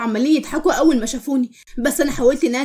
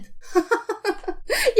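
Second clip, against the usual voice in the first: high, soft scornful laughter; mocking laugh; 9 semitones higher, 7 dB quieter than usual. Nour laughs off talk of inner beauty, mockingly. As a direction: laugh in her face, high and airy.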